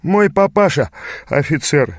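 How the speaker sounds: high, lazily unbothered youth; yawning. A narrator's reading. Youth boasting of his officer father, yawning. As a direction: toss it off as if through a yawn. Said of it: "зевнул юнец".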